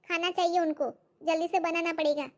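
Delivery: ordinary delivery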